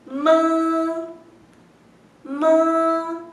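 ma held so the nose vibrates; nasal ma. Shown as a demonstration. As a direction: hum ma into your nose. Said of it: "Debe sentirse que tu nariz está vibrando".

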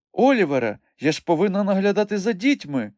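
high, indignant woman's objection; protesting. A narrator's reading. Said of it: "запротестувала вона".

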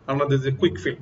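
ordinary talk

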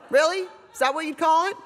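incredulous laughing jab; sarcastic disbelief. Real, not acted. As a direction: scoff at her with a laugh. Sarcastic retort to celeb tweet.

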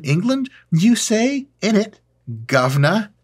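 high-pitched, hammed-up British accent; mock British accent. Host teasing London viewers, mock British accent.

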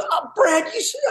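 high-pitched mock outburst; imitated annoyance. Pranked brother-in-law scolding the caller, imitated annoyance. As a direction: act out a fed-up clerk's exasperated cry. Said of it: "get all pissed".

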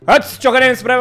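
imitating a very loud, high street yell; hammed-up shouting like the loud vlogger; mock loud Dorian. Mocking the vlogger who always talks loud, with a high yelling voice. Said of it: "vorbește așa de tare".

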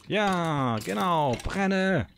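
high, loud gleeful cheer. Real, not acted. Player cheering as the monster burns, high and gleeful.

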